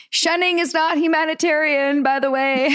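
high, drawn-out singsong retort; called-out taunt. Retold or imitated. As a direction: reenact calling pointedly after people who ignore you. Calls retort after those shunning her.

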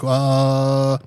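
long drawn-out, level "uh"; stalling. Retold or imitated. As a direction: imitate a clerk stuck for an answer. Imitating the agent stalling.

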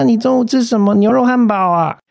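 high, chirpy mock doorman greeting; nosy doorman. Mimicking the chatty building manager, high and sing-song. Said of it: "他每次看到你都要寒暄个几句".

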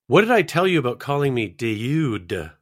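"dude" stretched into three syllables, slow; drawn-out dude. Shown as a demonstration. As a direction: drag "dude" into de-ju-ud. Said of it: "Give me "dude" in three syllables".

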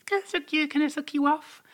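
mocking the guy. Imitating the guy asking her, high mock plea. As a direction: squeak it out like a creepy suitor. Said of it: "he was like, really".

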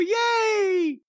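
high, far mock cheer; crowd cheer.

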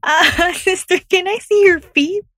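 very high, sing-out pretend politeness; mock polite request. Joking about asking a nun a favor, mock polite.

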